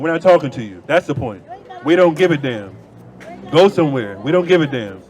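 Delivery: normal talking voice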